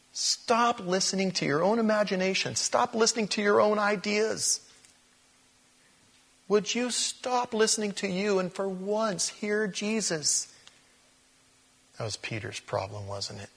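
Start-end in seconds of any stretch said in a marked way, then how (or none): 0.1-10.5 high, strained plea without extra volume; high-pitched exhortation. Acted. Preacher urging listeners to hear Jesus, high and insistent. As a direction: plead up high, slowing at the end.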